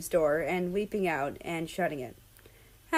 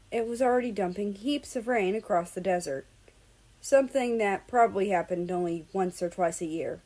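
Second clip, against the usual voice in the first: plain reading voice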